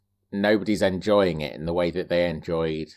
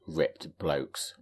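low, soft, lingering lusty tone; 4 semitones lower, 9 dB quieter than usual. Real, not acted. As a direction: savour it lustily, softly. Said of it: "said that in a very lusty way".

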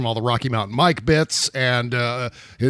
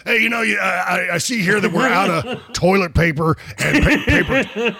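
Alex Jones impression; 5 semitones higher than usual. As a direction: rant like a conspiracy radio host about running out of paper towels. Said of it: "that's what I do with the Alex Jones voice".

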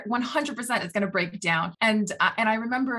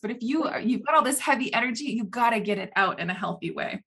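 ordinary delivery

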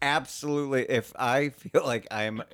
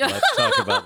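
laughing; 9 dB louder than usual. Retold or imitated. Laughing mid impression.

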